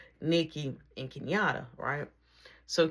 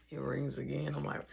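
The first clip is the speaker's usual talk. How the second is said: ordinary talk, nothing marked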